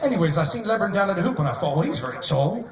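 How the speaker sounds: mock British athlete at a press conference; lightly done British accent. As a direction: ham up a chatty English bloke. British basketball player impression.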